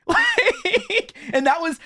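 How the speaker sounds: very high, drawn-out squeal through laughter; laughing disbelief. Real, not acted. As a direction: stretch it into a high, incredulous laughing whine. Laughing disbelief at copied characters.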